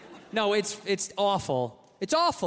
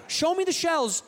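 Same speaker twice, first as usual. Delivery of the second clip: indignant demand; 9 semitones higher than usual. Acted. Incredulous demand, repeated.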